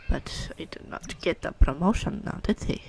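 whisper, breathless and unvoiced; whispered aside. Real, not acted. Player gossips about the sim's promotion, whispered. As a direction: let it slip as a secret.

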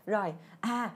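ordinary delivery

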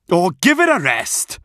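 high-pitched Welsh parent yelling; fed-up shout. Acted. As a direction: shout back at the heckler in a Welsh voice. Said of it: "shouted one of the parents".

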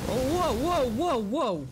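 sudden alarmed yells; startled yelling. Real, not acted. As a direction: yell out in shock.

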